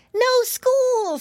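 very high, loud piggy squeal. A narrator's reading. Piggy boy tells rabbit uncle the news, squealing. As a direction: squeal the news like an overjoyed child. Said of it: "squealed Curly".

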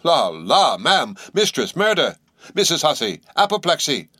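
high, frantic cries of alarm. A narrator's reading. Chambermaid fleeing to the kitchen, crying out high and frantic. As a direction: cry out in panic. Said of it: "with these cries".

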